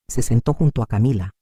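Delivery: plain reading voice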